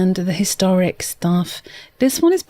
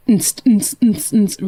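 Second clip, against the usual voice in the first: spat-out rhythmic techno beat; mimicked dance beat; slower than usual. Streamer showing music she doesn't mean, imitated fast beat. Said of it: "really fast stuff".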